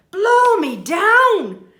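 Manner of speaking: high, loud, slow blown-away exclaim; astonished burglar. Acted. Burglar hears a break-in, astonished. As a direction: gasp out the shock. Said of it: "says Burglar Bill".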